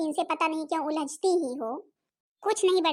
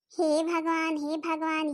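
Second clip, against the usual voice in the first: ordinary delivery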